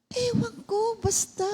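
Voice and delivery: sick man dodging the pastor's question, high and evasive. Retold or imitated. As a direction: shrug it off in a high voice. Patient's evasive reply voiced.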